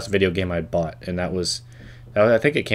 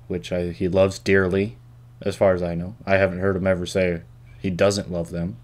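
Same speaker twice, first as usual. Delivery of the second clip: normal talking voice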